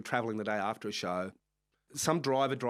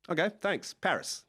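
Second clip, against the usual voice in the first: usual speaking voice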